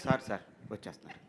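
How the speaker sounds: soft, fast nervous reply; hurried timid assistant. Retold or imitated. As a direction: gabble it out meekly to calm the boss. Assistant hastily reassures director.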